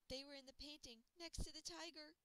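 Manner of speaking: lightly voiced girl, curious; Luna's voice. A narrator's reading. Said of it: "she said".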